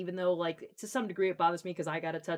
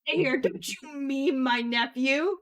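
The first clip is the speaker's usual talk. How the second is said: loud, slow, higher mock fury; mock outrage; 7 semitones higher, 9 dB louder, slower than usual. Aunt mock-scolding a waiter for memeing her nephew, loud slow outrage. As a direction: ham up being offended. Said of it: "And I'm like".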